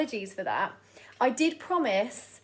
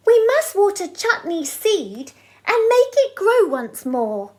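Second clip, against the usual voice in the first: put-on children's voice; 9 semitones higher, 9 dB louder than usual. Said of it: "the boy and girl next door said".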